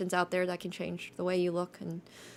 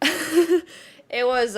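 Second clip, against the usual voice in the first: laughing; 8 semitones higher, 8 dB louder than usual. Real, not acted. Laughing about barely passing.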